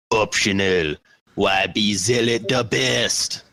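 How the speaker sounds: slow, drawn-out troll voice. Acted. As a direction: read it slowly like a troll. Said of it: "like a troll or a goblin".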